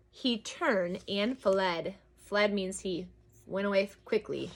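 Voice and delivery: hushed narration. A narrator's reading. Story slows to the ending.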